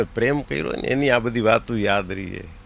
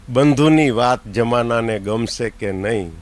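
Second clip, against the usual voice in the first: poem recital; 3 semitones lower than usual. Acted. Poet reciting his own verse to the audience.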